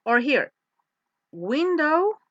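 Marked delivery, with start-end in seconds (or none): 1.3-2.2 WINDOW swept up high and loud; rising intonation. Shown as a demonstration. Teacher showing students a seat choice question, rising intonation. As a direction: lift "window" as the first of two options. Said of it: "the intonation goes up".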